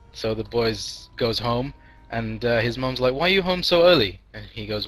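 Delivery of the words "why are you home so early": higher, louder questioning; surprised mom. Acted. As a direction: play a mother caught off guard. Mom surprised at early homecoming.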